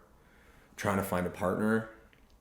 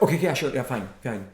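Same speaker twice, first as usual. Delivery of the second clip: higher, louder, rushed dismissive okay; brushing it off; 5 semitones higher, 9 dB louder than usual. Shown as a demonstration. Speaker showing how not to answer a partner, dismissive. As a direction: rattle off agreement to brush someone off. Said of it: "it sounds like you're brushing it off".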